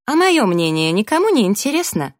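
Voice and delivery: high, loud, pointed question; indignant interruption. A narrator's reading. Narrator cutting into friends' quarrel, loud indignant question. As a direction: break in, demanding to be heard.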